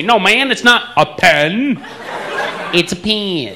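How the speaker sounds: slow, high Texas accent, mocking the first PEN; Texan imitating me. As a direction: contrast mimicked PEN with Texan "pee-in". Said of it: "He tries to imitate me".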